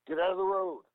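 barked through a bus loudspeaker; loudspeaker yell. Retold or imitated. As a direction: yell it like over a PA. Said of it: "gets on his fucking loudspeaker and goes".

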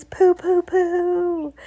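high, loud, drawn-out poohs; disgusted crowd hooting; screamed jeer. A narrator's reading. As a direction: hoot each pooh high and long. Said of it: "screamed the audience".

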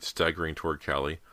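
usual speaking voice

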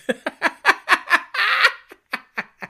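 high, loud burst of laughter; big real laugh. Real, not acted. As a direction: cackle out loud at the joke. Laughing at pilgrims joke.

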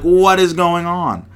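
high, loud, slow retold panic; freaking out. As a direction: replay panicking about the ants. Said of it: "I'm like".

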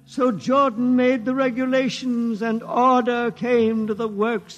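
slow, measured chant of scripture; solemn recitation. Acted. Old witness reciting the sacred legend, slow and solemn. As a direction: intone it like holy verse.

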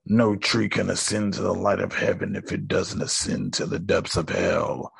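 low, measured villain line; villain's quote. Retold or imitated. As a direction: recite it low and slowing, like a solemn villain. Quoting the villain's grand line.

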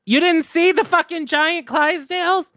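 very high-pitched outraged disbelief; hammed-up exasperated question; mock incredulity. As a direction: squeal it as an astonished comeback. Acted quarrel, incredulous comeback.

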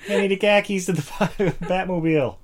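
hammed-up Boston Batman asking for the Batmobile; joking Boston accent Batman line, half laughing. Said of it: "I wish he would do it in a Boston accent".

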